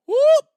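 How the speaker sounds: very high, loud whooping sound; sudden high whoop; whoop sound effect. Shown as a demonstration. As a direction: burst out a sound-wave noise. Imitating a thought arriving.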